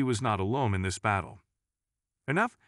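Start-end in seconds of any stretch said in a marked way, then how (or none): 2.3-2.6 authoritative. A narrator's reading. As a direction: cut them off with authority. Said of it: "his voice resonating with authority".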